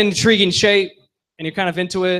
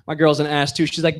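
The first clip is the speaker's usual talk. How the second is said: usual speaking voice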